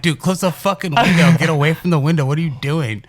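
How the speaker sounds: mock shout of panic at a friend; retold yell at Derek.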